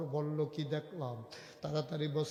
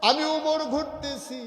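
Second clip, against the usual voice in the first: high, loud, slow drawn-out cry; full-force preaching; 11 semitones higher, 13 dB louder, slower than usual. Acted. Preacher painting Umar's night walk, full-force cry. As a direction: belt out each phrase and hold its end.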